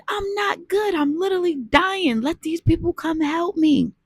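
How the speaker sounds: high-pitched silent pleading; screaming inside her head; desperate inner voice. Retold or imitated. Drowning woman thinks to herself, high desperate pleading. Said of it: "And I'm thinking".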